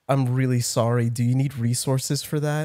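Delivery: soft sympathetic nurse. Retold or imitated. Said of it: "she had really sad eyes".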